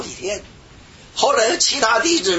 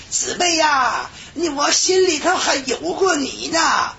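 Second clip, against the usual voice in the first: slow mock tender confession; 12 semitones higher, slower than usual. Brother confessing love to sister.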